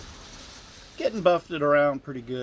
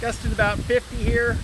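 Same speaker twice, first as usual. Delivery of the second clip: raised voice over the storm; shouting over wind; 8 semitones higher, 4 dB louder than usual. Real, not acted. Outdoors in hurricane wind.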